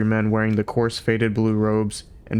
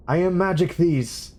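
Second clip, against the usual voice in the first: pompous announcement; 8 semitones higher than usual. A narrator's reading. Older philosopher announcing himself to the programmers, grand. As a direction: declare your name grandly. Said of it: "announced the older one".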